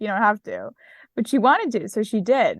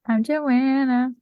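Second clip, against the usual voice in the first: slow, polished cabin announcement; flight attendant voice; 3 semitones higher, slower than usual. Pretend. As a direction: sound like a flight attendant greeting passengers. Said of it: "Were you trying to sound like a flight attendant?".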